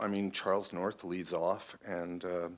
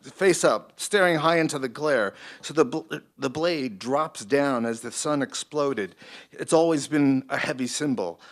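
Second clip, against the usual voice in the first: higher, louder Brooklyn producer voice; Brooklyn accent; 5 semitones higher, 5 dB louder than usual. Acted. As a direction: push the lines in a Brooklyn accent. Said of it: "I always make it a Brooklyn voice".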